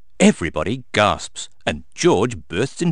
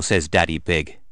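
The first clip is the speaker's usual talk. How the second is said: ordinary delivery, nothing marked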